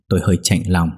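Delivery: usual speaking voice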